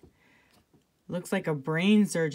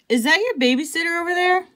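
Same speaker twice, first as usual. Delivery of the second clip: put-on higher, curious kid asking; curious child voice; 10 semitones higher, faster than usual. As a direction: ask it like a nosy classmate. Classmate voiced in story.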